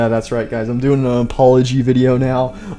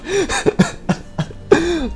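high, breathless giggle burst; squeaky burst of laughter. Real, not acted. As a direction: crack up helplessly. Breaks into laughter.

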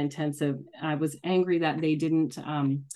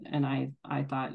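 normal talking voice

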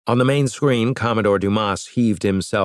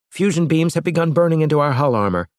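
higher, fast officer report; Valerie's voice; 8 semitones higher, faster than usual. A narrator's reading. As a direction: report the jamming briskly, pitched up.

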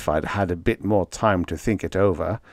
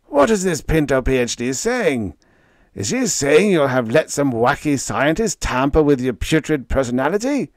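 higher, loud outraged bellow; 5 semitones higher, 4 dB louder than usual. A narrator's reading. Leo bellowing at Freddie about the scientist. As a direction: roar the questions, disgusted. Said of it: "he bellowed".

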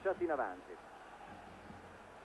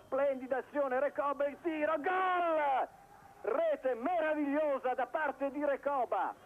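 rising, higher excited play-by-play; goal call; 6 semitones higher, slower than usual. Real, not acted. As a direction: build to the goal shout. Commentator calls a goal.

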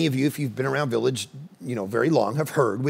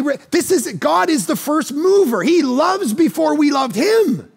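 raised, high and emphatic, pressing the point; fervent sermon voice; preaching at full force; 11 semitones higher, 4 dB louder than usual. Acted. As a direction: preach it hard to the congregation.

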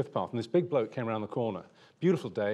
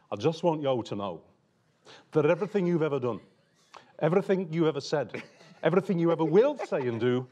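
hammed-up Brummie accent, building louder; terrible Birmingham accent; 3 semitones higher, 6 dB louder than usual. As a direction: imitate a loathing Brummie, slowing hard on the last words. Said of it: "I do a terrible Birmingham accent".

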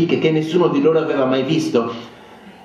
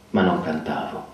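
usual speaking voice

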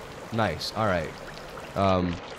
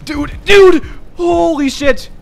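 loud high shout of alarm; panicked yell; 10 semitones higher, 6 dB louder than usual. Real, not acted. Streamer yelling at danger in the game, panicked shout.

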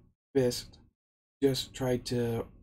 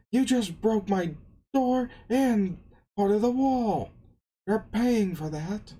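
loud, high-pitched indignant rant; angry scolding; 9 semitones higher, 5 dB louder than usual. Retold or imitated. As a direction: yell it like an outraged boss. Acted scolding over broken door.

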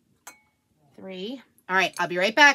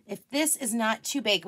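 ordinary delivery

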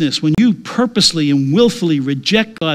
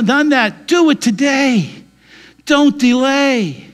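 raised, urgent gospel appeal; fervent preaching; 6 semitones higher than usual. Acted. Preacher urging listeners to believe today, fervent and raised. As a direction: plead with them to believe today.

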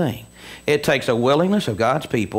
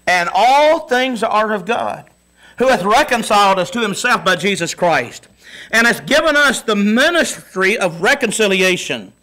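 scripture reading; 6 semitones higher, 3 dB louder than usual. Acted. Reads scripture, preaching.

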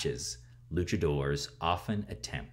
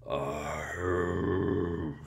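mummy groan. Acted. Mummy rises to face Nino.

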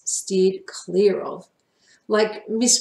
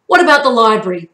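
sudden idea; 3 semitones higher, 10 dB louder, faster than usual. Acted.

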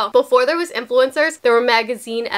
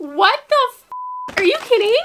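shocked disbelief; 9 semitones higher than usual. Real, not acted. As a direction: blurt it out in high-pitched astonishment. Shocked at surprise PR package.

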